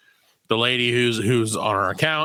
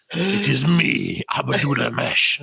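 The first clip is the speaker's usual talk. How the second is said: slow intro; much slower than usual. Retold or imitated. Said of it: "he's like".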